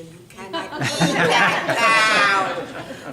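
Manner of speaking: loud, stretched-out objection; slow, loud motherly veto; emphatic refusal. Acted. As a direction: draw the words out loudly for comic outrage. Mother forbids eating the cow.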